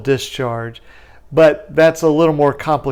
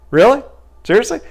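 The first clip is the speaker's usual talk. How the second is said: higher, eager disbelief; mock surprise; 9 semitones higher, faster than usual. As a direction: act amazed at the lucky break. Lawyer relieved, surprised.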